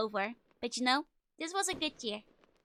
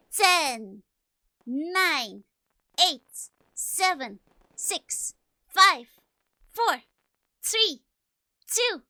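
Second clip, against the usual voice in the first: loud countdown call; new year countdown crowd; 2 semitones higher, 8 dB louder, much slower than usual. Real, not acted.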